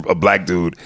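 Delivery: normal talking voice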